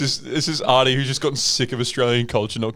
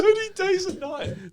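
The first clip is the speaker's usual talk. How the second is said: high, slow spooky repeat; eerie echo. Pretend. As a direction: echo it like a ghost story.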